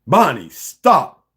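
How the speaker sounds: loud slow drawn-out yell; shouting. Acted. Malcolm yelling at enraged Bonnie, drawn-out shout. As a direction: cry it out long and hard.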